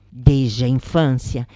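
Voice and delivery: ordinary delivery, nothing marked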